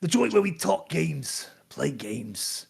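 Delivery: low, gravelly-free hard-boiled radio host; noir detective. Acted. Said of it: "a detective noir voice".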